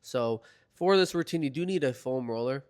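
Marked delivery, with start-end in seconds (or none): none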